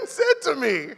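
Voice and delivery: usual speaking voice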